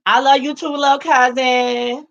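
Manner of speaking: high, cooing, drawn-out affection; sweet sing-song. Real, not acted. Answering teasing little cousin Justin, high sweet coo. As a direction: coo it, stretching the last words.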